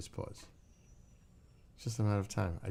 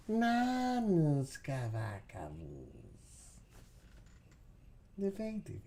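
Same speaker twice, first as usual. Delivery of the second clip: high-pitched cooing to a dog; 10 semitones higher than usual. Real, not acted. Owner cooing to the baby husky, high baby talk.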